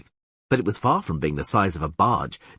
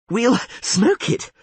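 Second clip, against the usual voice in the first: higher, slow out-of-breath words; winded bard panting, higher and slow; 14 semitones higher, 5 dB louder, slower than usual. A narrator's reading. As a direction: gasp it out after the struggle. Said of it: "panted Dandelion".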